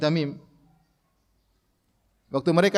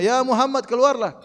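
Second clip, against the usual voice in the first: rude calling out; 7 semitones higher than usual. Retold or imitated.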